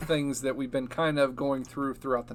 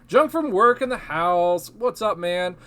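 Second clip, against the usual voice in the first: loud, high hype greeting; excited shout-out; 6 semitones higher, 9 dB louder than usual. Real, not acted. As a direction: hype up the arriving viewer. Shouting out a live viewer.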